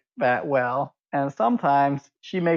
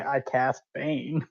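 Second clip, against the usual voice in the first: slow deliberate line; 4 dB quieter, much slower than usual. Retold or imitated. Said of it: "he doesn't say".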